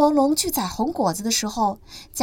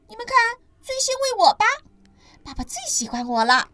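put-on much higher child voice, teasing; boastful little ankylosaur; smug baby dinosaur; 11 semitones higher, 4 dB louder than usual. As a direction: prove you're the favourite.

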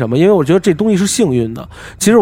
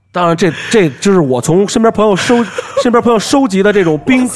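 higher voice broken up by laughter; laughing; 7 semitones higher than usual. Real, not acted. Storyteller wrapping up hotel ghost stories, laughing through it. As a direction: keep explaining through the laughs. Laughing after friend's reaction.

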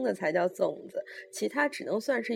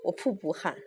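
usual speaking voice

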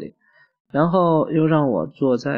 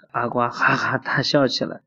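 plain reading voice

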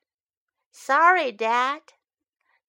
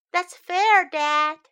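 lightly voiced child; 7 semitones higher, 6 dB louder than usual. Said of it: "I say".

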